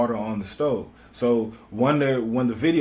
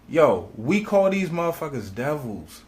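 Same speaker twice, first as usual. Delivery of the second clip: a lightly put-on voice, higher than his own; quoting a friend; 5 semitones higher than usual. Retelling what a friend said about the police, lightly voiced. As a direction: give the line as someone else's, half in character. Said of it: "he said".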